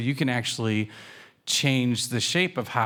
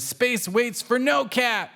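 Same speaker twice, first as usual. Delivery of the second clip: high, slow, bold kitten voice; Pancake; 12 semitones higher than usual. A narrator's reading. Kitten zooming off, grand high declaration. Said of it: "Pancake zooms off and says".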